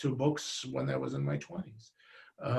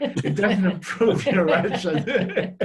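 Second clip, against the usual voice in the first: laughing; 2 semitones higher, 5 dB louder than usual. Real, not acted. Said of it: "but that's just loud".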